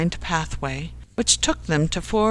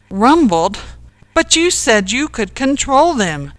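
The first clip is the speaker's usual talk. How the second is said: higher, louder indignant protest; spluttering disbelief; 6 semitones higher, 7 dB louder than usual. A narrator's reading. Ken challenging Jack over the trousers, spluttering. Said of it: "spluttered Ken".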